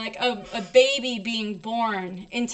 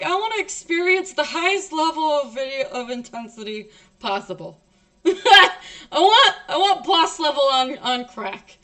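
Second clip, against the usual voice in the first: over-excited mock bravado; eager souls; 2 semitones higher, 8 dB louder than usual. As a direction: play a thrill-seeker ordering the hardest challenge, breaking into laughter. Souls greedily choosing intensity, voiced.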